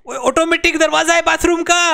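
high excited shout; amazed shout. Real, not acted. Gamer shows viewers the bathroom door, amazed shouting.

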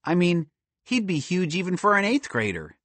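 put-on high, uneasy kid voice; mildly anxious, pitched high; nervous wondering. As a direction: marvel nervously at the bully's size. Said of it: "Justin wondered nervously".

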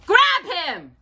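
high, forceful storybook shout; rousing command to seize someone; shouted order. A narrator's reading. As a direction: shout it like a mob leader. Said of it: "they shouted".

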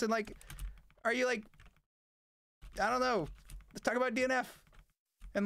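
high, loud mocking fan voice; mock the pestering chat in a shrill squeal; whiny fan voice. Streamer mocks nagging chat, high and loud. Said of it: "everyone's like".

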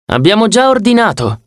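put-on high, cool girl's voice; a frowning girlfriend's cool remark. Annabeth to Percy as he arrives, in a high cool voice.

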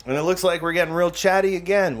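normal talking voice

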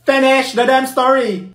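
high, loud, slow demand; exasperated yell. Acted. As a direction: yell it in frustration. Exasperated friend yelling.

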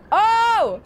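high, loud delighted gasp; sudden happy surprise; surprised. Real, not acted. Streamer notices raid.

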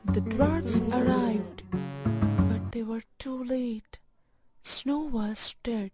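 usual speaking voice

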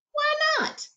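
put-on high, puzzled little-girl question; puzzled child. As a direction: ask it like a baffled child. Child asking, pitch jump.

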